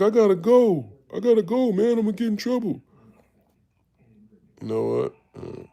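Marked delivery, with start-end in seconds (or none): 0.0-2.8 put-on much higher, louder panicky little-kid plea; imitating a nervous kid trying to leave; scared kid. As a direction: beg your way out like a frightened child.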